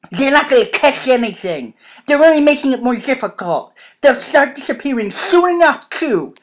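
heated, projected dialogue voice; Jacob, arguing. Acted. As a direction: argue it insistently, well above narration level.

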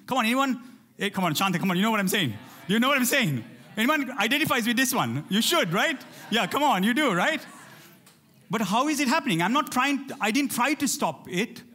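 building passionate sermon delivery; preaching at full force. Acted. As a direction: preach it hard to the congregation. Rallying the congregation for response.

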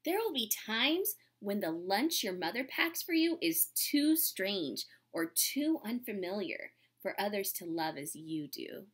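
gentle, unhurried storybook reading; reading to children. Acted. Teacher reading a storybook to her class, gentle and unhurried. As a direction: read it like a picture book to the class.

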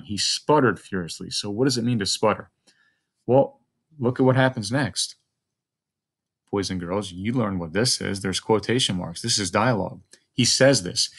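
normal talking voice

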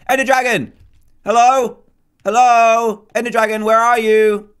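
loud, high hello into the void; calling out. Real, not acted. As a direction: call out to find the dragon. Calling out to dragon.

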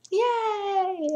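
high squealed yay; a sarcastic little cheer; mock excited cheer. Announcing an ask video.

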